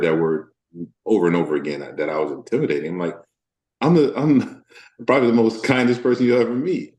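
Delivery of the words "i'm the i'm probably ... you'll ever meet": high-pitched, laughing disbelief; amused protest. Retold or imitated. Actor answering being called intimidating, in high amused protest.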